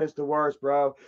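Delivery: usual speaking voice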